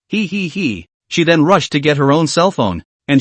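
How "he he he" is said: soft breathy giggle; gleeful giggle. Acted. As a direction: giggle quietly to herself.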